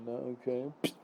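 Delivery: normal talking voice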